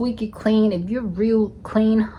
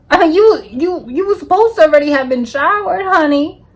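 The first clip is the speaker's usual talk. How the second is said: high, loud, exasperated honey; scolding her hookup, high and exasperated; exasperated sass; 6 semitones higher, 3 dB louder than usual. Retold or imitated.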